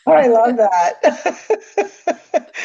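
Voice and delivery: real amused laughter in the voice; laughing delight. Real, not acted. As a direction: say it while breaking into a laugh. Delighted laugh at guest's slogans.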